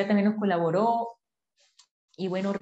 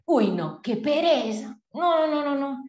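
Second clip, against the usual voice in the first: higher, louder mock disgust; 6 semitones higher, 5 dB louder, slower than usual. Rejecting self-help ads on Facebook, mock disgusted. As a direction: wave it all away, hammed-up disgust. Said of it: "en ese estado de conciencia".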